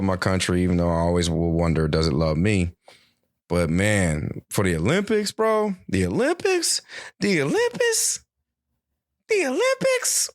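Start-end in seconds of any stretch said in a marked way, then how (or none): 4.5-10.3 rising Olympic excitement. Real, not acted.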